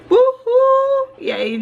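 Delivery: high, loud woohoo; high drawn-out celebratory whoop; cheer. Acted. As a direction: whoop it up for the fans. Cheering for her loyal subscribers.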